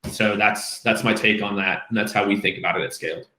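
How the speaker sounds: ordinary talk, nothing marked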